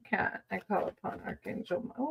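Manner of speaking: hushed, soft calling on the archangel; whispered invocation. Acted. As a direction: murmur the summons like a quiet prayer. Whispered invocation over tarot cards.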